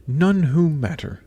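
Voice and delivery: slow, high, hissing stepmother; drawn-out dismissive hiss. A narrator's reading. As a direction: draw it out with disdain. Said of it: "said the stepmother with a hiss".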